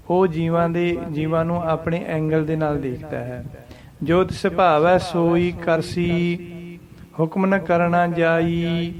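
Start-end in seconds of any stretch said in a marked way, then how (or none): 4.0-8.9 slow recitation resting once after ਕਰਸੀ; ਜੋ ਤਿਸ ਭਾਵੈ ਸੋਈ ਕਰਸੀ, rest, ਹੁਕਮ ਨ ਕਰਨਾ ਜਾਈ; one pause after ਕਰਸੀ. Shown as a demonstration. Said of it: "ਇੱਕੋ ਹੀ ਵਿਸ਼ਰਾਮ ਆਊਗਾ ਕਰਸੀ ਦੇ ਉੱਪਰ". (ਕਰਸੀ ਤੋਂ ਬਾਅਦ ਇੱਕ ਵਿਸ਼ਰਾਮ)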